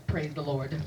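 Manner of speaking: slow, overpronounced preacher drawl; lightly mock a TV evangelist. Comic mocking televangelists to the crowd, slow overpronounced preacher voice. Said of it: "they all talk like this".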